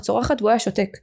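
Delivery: ordinary talk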